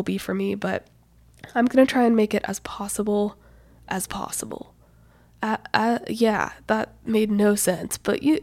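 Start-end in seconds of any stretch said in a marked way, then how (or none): 3.9-4.7 whispered echo, much softer; whispering. Real, not acted. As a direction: drop to a breathy whisper for the repeat.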